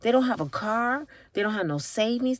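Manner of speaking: usual speaking voice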